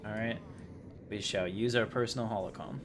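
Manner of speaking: usual speaking voice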